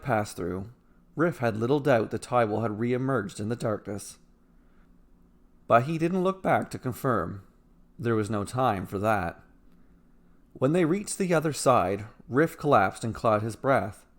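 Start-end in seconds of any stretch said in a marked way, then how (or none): none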